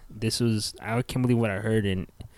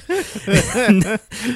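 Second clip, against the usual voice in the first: a loud, high burst of laughter; 11 semitones higher, 9 dB louder than usual. Real, not acted. Laughing hard at the dad's crude order, loud burst.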